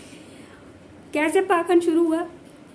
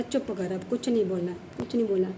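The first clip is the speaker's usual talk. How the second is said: much lower and softer, under her breath; hushed self-warning; 6 semitones lower, 6 dB quieter than usual. Retold or imitated. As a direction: hold it in quietly, telling yourself to keep still. Telling herself to stay silent.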